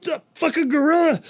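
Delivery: high yelping outrage; outraged lion. Acted. Lion turning round on the gorilla, high and outraged. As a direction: shriek like a lion just violated. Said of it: "the lion turns around".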